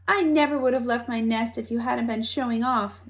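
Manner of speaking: plain reading voice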